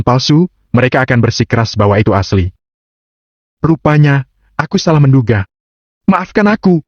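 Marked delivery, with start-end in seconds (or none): 3.6-6.8 lightly voiced young man, pitched up; Agam. A narrator's reading. Agam feigns admitting he guessed wrong, mock-apologetic. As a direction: apologize in a higher voice, rising toward the end.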